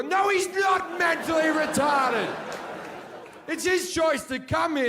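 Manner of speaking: high, loud protest, half laughing; indignant shout. Retold or imitated. Telling the hooker off, loud and high. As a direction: bellow it like you're deeply offended.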